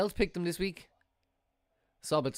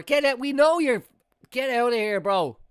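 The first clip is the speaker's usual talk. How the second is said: high, loud get out of here; yelling at the screen; 7 semitones higher, 8 dB louder than usual. Real, not acted. Fan yelling at a player faking injury, loud. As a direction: shout the player off.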